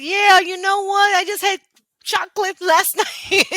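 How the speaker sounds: high, slow, playful confession; drawn-out high joking reply; pretend breezy admission. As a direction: own up to it with mock cheer. Imagined breezy reply to insult.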